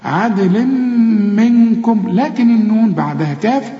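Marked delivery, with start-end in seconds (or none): 0.0-2.1 عدل منكم with the tanween merged into a hummed م; higher, ringing عدل منكم with lingering nasal hums; merged meem. Shown as a demonstration. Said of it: "الميم من حروف الإدغام".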